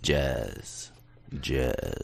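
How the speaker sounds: low, drawn-out imitation of a radio host; imitating deep DJ voice. Guest trying to match the host's word, imitated deep voice. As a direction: copy a sexy DJ's deep delivery. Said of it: "say jazz like you say jazz".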